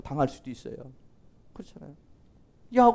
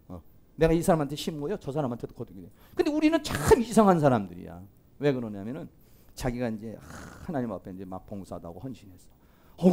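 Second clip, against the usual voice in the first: normal talking voice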